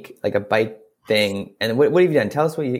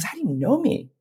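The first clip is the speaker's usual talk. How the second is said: high, softer bewildered puppet voice; puzzled Punchinello; 11 semitones higher, 4 dB quieter than usual. Acted. Punchinello questions the wood carver who knows him, high puzzled voice. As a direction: ask it small, high and baffled. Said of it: "He goes".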